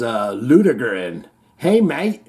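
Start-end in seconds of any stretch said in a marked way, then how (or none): 1.6-2.2 put-on Australian accent. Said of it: "when they say mate".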